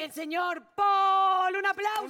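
drawn-out, high game-show announcement; big emcee build-up for applause; mock announcer. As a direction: announce the winner, stretching the name loud and long. Announces the winning liar to applause.

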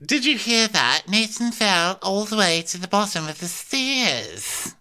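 high, slow gossipy voice; mock gossip. Host imitating a crew member spreading news. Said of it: "he's like".

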